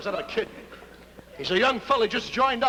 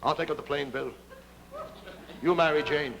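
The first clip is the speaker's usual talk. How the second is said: low, measured noble offer; gravely volunteer in a lowered voice; heroic pilot; 5 semitones lower than usual. Acted. Smiling Jim offering to fly for Bill, low and solemn. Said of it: "Smiling Jim speaks first".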